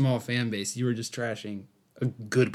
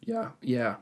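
voice cracking; 6 dB quieter than usual. Real, not acted. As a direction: voice cracks mid-word. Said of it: "My voice just cracked".

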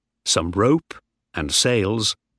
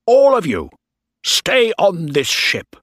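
commanding shout; 9 semitones higher, 5 dB louder than usual. Acted. Bligh shouting orders to crew.

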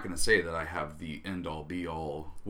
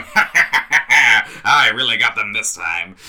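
gloating cartoon villain laugh, louder; mustache twirling villain; 9 dB louder than usual. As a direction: cackle like a mustache-twirling bad guy. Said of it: "standing on a cliff going".

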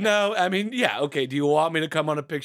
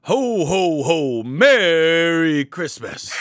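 half-hearted slow Santa; a weak, drawn-out Santa ho ho ho; Santa impression; 4 dB louder, much slower than usual. Shown as a demonstration. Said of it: "your guys' best Santa impression".

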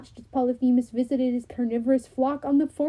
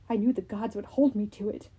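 plain reading voice

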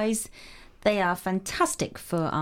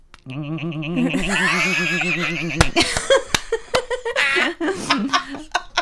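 chattering cat noise; rapid ngngng chatter like a cat; cat chattering at birds; 4 semitones lower, 4 dB louder than usual. Shown as a demonstration. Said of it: "Do that again".

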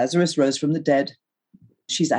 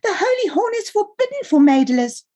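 put-on very high, squeezed boy's voice; piping, pious kid brother; wheezing little brother; 3 dB louder than usual. A narrator's reading. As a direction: wheeze it out high, laying down the law. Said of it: "he wheezed".

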